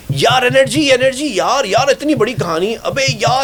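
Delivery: imitated yelling. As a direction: mimic a shift in-charge yelling at the anchor. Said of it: "وہ چیخ رہے ہوتے ہیں".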